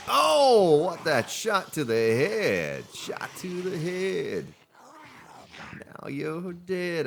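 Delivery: high, slow sing-song gloat; mock triumphant taunt at the dead zombie. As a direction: savour the headshot, drawing it out.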